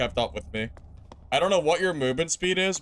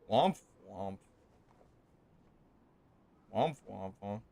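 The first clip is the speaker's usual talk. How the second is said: mock sad trombone; 6 semitones lower, 8 dB quieter than usual.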